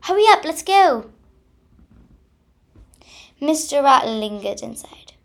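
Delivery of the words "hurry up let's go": eager hurry. Acted. Excited squirrel rushes his friend.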